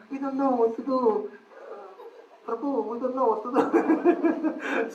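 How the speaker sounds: high-pitched mock-plaintive voice; helpless novices. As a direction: plead helplessly, with amusement showing through. Imitates the helpless ladies coming to him.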